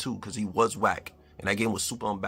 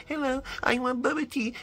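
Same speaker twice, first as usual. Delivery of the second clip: very high cutesy VTuber voice; mock a VTuber in a squeaky high pitch; put-on high-pitched streamer girl voice; VTuber impression. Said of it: "y'all used to watching the VTubers".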